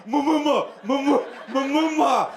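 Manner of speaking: "Mumuma" stammered on the first syllables; mock stutter. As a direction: stutter through "mumu-, mumuma". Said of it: "Did your mother have a stutter?".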